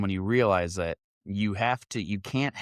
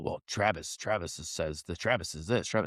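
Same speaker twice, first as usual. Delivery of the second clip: low, soft mumbling imitation of Howard; lowered, muttered mockery of a rambler; mocking Howard; 6 semitones lower, 6 dB quieter than usual. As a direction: mumble it low like a bumbling fool.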